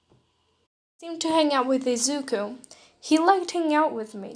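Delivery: ordinary delivery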